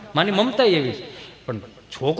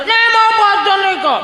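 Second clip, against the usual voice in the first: very high bratty protest; mock child tantrum; whining child; 12 semitones higher, 4 dB louder than usual. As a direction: squeal the refusal like a spoilt kid. Acts child refusing food.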